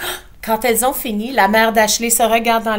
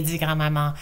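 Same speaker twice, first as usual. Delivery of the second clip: usual speaking voice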